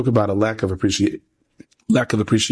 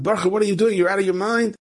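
high-pitched mock yell without much volume; imitating an exasperated shout; retold yell; 10 semitones higher than usual. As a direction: call out in disbelief, pitched high. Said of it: "the guy yells out".

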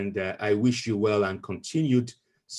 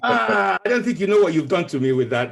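laughing, fast and louder; talking through delighted laughter; 8 semitones higher, 5 dB louder than usual. Real, not acted. Laughing after the announcement.

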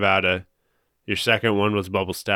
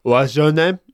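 higher, louder mimic of the phone rep; co-host mimics the cancellation agent, higher and louder; imitating the phone agent; 6 semitones higher, 5 dB louder, slower than usual. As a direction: copy the customer service guy's voice. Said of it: "I'll do it".

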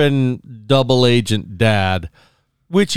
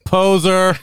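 quoted teasing jab; 6 semitones higher than usual. Retold or imitated. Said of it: "and she's like".

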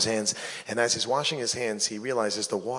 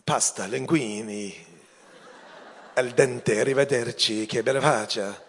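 mock Italian. Mock Italian gibberish from mobsters.

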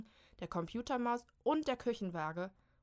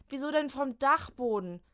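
higher, louder whining complaint; 5 semitones higher, 9 dB louder than usual. Acted. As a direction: moan about the attic. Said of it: "jammert Molly". (jammernd klagen)

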